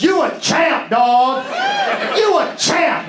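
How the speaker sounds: high, excited calling-out; hyped shout. Retold or imitated. Bystander with the phone cheering the comic, shouting. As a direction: shout it like an amazed fan. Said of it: "He goes".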